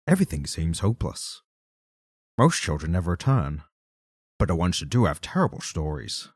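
Sean Connery-ish narrator voice; Alan Moore voice. Acted. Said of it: "I want you to do Alan Moore".

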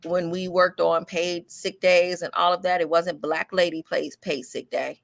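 normal talking voice

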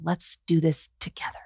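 hushed, near-whispered ad voice; imitating commercial voiceover. Voiceover artist parodying identical pandemic commercials, hushed mock-sincere. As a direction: mimic a sincere ad read, almost whispering. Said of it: "all the commercials were exactly the same".

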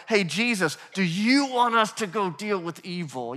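mildly pushy, eager question. Retold or imitated. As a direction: imitate someone itching to take action. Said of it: "They are on the offensive here".

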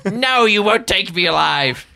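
high and loud, defiant; defiant cornered cry. Acted. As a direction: yell it while surrounded by guards. Norbert defiant while surrounded.

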